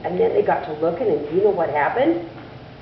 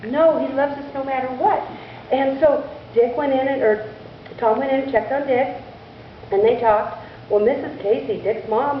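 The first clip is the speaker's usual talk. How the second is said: usual speaking voice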